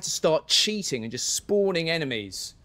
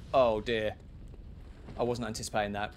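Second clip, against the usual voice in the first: ordinary talk, nothing marked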